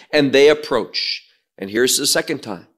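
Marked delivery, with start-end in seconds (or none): none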